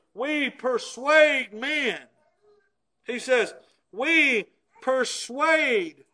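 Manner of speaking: fervent preacher proclaiming scripture; full-force preaching. Acted. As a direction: preach it out, rising and pressing. Preaches Paul's pleading words.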